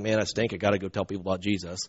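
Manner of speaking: low, quiet, hurried grumble; mock phony piety. Phony believer feeling he's gotta go tell people, low half-hearted mumble. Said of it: "he's not doing it as a phony".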